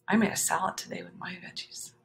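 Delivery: soft whispered confidence; whispered aside. Real, not acted. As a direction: whisper it like a secret.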